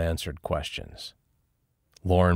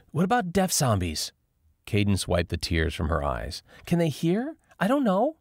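Lauren's voice; 8 semitones higher than usual. A narrator's reading. Lauren asks, high voice.

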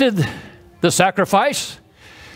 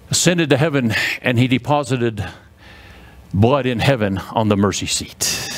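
normal talking voice

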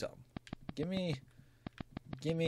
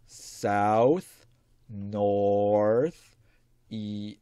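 drawn-out words with long pauses; slow dictation; 2 semitones lower, 6 dB louder, much slower than usual. Pretend. Player repeating directions slowly as he writes them, drawn-out dictation. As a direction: stretch each direction out slowly. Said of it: "Can you say that again slowly?".

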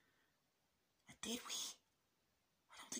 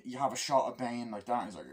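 normal talking voice

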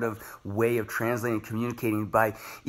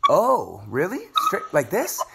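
higher, playful fake surprise; mock surprised; 6 semitones higher, 4 dB louder, slower than usual. Athlete answering his coach, mock surprised and higher. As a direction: act innocently amazed at the obvious. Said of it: "And I'm like".

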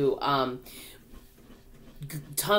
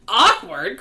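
loud, raised, incredulous question; indignant cry; 6 semitones higher, 11 dB louder than usual. A narrator's reading. Earthworm answers the old grasshopper, indignant cry. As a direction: throw the word back in outrage. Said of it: "cried the earthworm".

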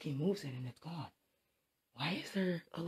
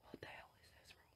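hushed, very soft whisper; nervous night vlog whisper; 20 dB quieter than usual. Real, not acted. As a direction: whisper it in dread. Whispering in dark.

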